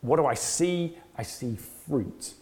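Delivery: ordinary talk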